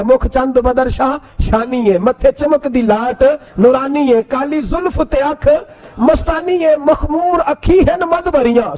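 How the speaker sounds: high, drawn-out Punjabi praise poetry; stretched devotional verse delivery; verse recitation. Acted.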